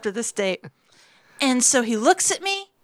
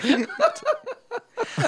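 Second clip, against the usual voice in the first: high laugh; 11 semitones higher than usual. Real, not acted. Laughing at own joke.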